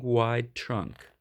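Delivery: ordinary delivery, nothing marked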